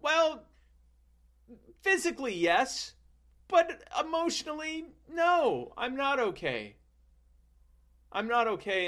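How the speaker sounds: put-on high, whiny little boy voice; childish self. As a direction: whimper it like a hurt French child. Young self voiced in story.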